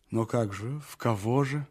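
usual speaking voice

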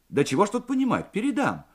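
high-pitched, slightly impatient operator; girlish voice. A narrator's reading. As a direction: brush it off as a young girl, quickly. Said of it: "девичий голосок". (девичьим голоском, скороговоркой)

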